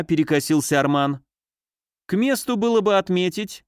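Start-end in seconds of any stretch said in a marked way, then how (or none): 2.1-3.6 higher-pitched, insistent announcement; mildly piercing, raised-pitch declaration; piercingly raised voice. A narrator's reading. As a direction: lift the voice high to be heard. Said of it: "до такой пронзительной ноты возвысил голос".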